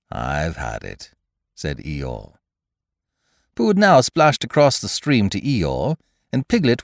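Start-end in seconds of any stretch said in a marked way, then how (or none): 0.1-1.1 slow, low donkey gloom; gloomy. A narrator's reading. Eeyore answering Pooh about presents, gloomy. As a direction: drag it out, low and resigned. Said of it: "said Eeyore gloomily".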